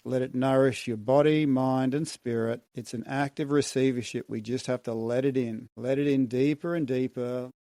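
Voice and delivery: calm, soothing relaxation guide. Shown as a demonstration. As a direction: ease them into release gently. Relaxation after the hum.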